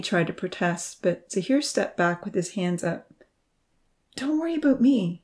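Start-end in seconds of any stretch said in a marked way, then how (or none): 4.2-5.2 almost harsh. A narrator's reading. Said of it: "he said almost harshly".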